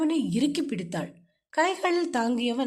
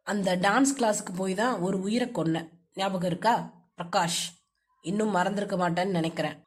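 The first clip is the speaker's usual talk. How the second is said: low, bitter man's voice; low-pitched, reproachful husband; accusing husband; 6 semitones lower than usual. Acted.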